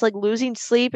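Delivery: normal talking voice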